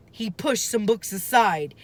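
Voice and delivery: plain reading voice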